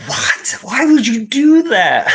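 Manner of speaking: put-on high, slow, stunned wail of a kid; shocked kid. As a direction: cry out in disbelief at dad. Boy upset at dad.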